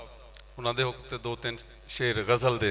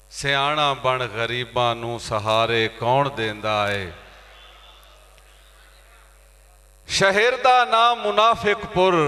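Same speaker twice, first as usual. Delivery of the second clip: ghazal recitation; 6 semitones higher, 6 dB louder, slower than usual. Acted. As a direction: recite couplets to an audience with drawn-out endings. (ਗਜ਼ਲ ਦੀ ਮੁਸ਼ਾਇਰੇ ਵਾਲੀ ਅਦਾਇਗੀ)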